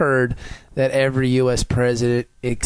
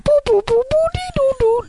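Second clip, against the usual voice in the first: toy robot voice. Pretend. Robot beeping noises.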